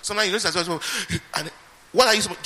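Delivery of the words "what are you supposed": excited exclamation. Acted. Preacher's sudden emphatic question.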